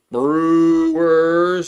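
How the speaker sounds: long drawn-out high, loud Brewers call; elongated team call. Shown as a demonstration. Greeting the Brewers fans in chat, a long drawn-out Brewers call. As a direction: stretch "Brewers" out like a stadium announcer. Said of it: "I gotta elongate".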